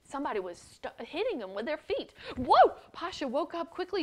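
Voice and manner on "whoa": high, loud startled whoa; sudden frightened whoa; startled cry. Acted. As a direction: jolt awake with a yelp. Startled boy wakes up.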